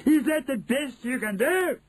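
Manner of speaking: high shrieking witch voice; shrill screeching Grand High Witch. Acted. Witch voice continues.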